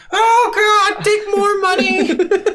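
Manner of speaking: high, slow, laughing half-shouted panic; a cowering cyclops begging the hero to take his cash; panicked troll. Retold or imitated. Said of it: "And he's like".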